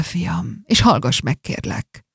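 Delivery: soft, fading whisper. Acted. Dying father whispering to the son bent over him. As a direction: hush it to the son leaning close. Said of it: "Hajolj ide".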